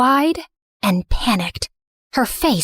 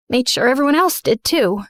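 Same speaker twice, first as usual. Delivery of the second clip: usual speaking voice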